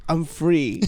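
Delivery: low, soft mock epiphany; blissful release. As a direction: sigh it out softly, looking up. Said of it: "you look up and you're like".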